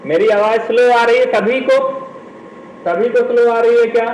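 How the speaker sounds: loud, quick check with the whole class. Real, not acted. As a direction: call out to ask everyone if you're audible. Checking if class can hear him.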